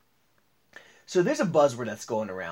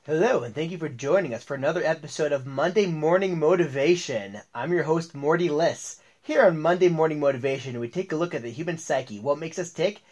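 upbeat show opening, slightly louder; podcast host intro; 2 semitones higher, 5 dB louder than usual. Acted. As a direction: welcome listeners like a radio host.

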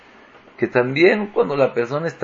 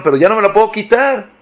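high, fast alarmed cry, louder; the king panics that the boot won't come off; mock alarm, rushed and much higher; 8 semitones higher, 5 dB louder, much faster than usual.

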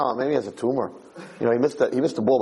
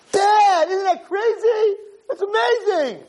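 very high, drawn-out squealing shout; hammed-up wild delight; thrilled kid; 4 dB louder, slower than usual. Kid boasting to Dad after another miss, thrilled.

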